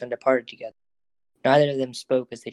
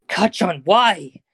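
high, loud indignant demand; 7 semitones higher, 7 dB louder, much slower than usual. Acted. Izuku to Kacchan after the kick, loud demanding cry. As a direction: Izuku protests being kicked, loud and high. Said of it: "Izuku demanded".

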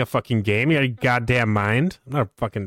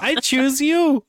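high, slow, drawn-out trainer's battle call; Pokemon trainer call; 14 semitones higher, much slower than usual. Pretend. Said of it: "You sound like a Pokemon".

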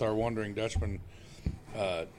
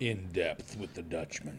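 announcer voice; 8 semitones lower, 10 dB quieter, slower than usual. Pretend. Echoing the new show title.

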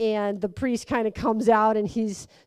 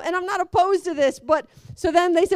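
ordinary talk, nothing marked

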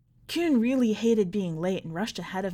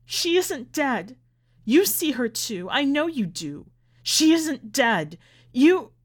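high, insistent cry building louder; scream; 4 semitones higher, 6 dB louder, slower than usual. A narrator's reading. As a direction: shout the denial, breaking off at the peak. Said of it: "Ma began to scream".

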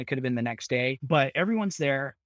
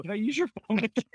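faster, higher, a touch sheepish; quicker second ask; 7 semitones higher, faster than usual. Retold or imitated. Bloodied man asking the clerk again, quicker and higher. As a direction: rush the request with a nervous edge. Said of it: "I ask the guy again".

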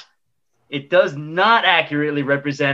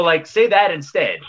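normal talking voice

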